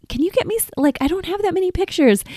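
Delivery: a light impression of Wes pleading for photos; imitating husband. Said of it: "he was like".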